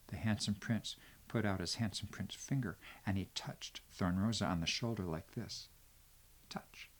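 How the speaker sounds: hushed whisper, barely voiced. A narrator's reading. Teller whispers as the prince touches Thorn Rosa, soft whisper. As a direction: whisper it so nobody wakes.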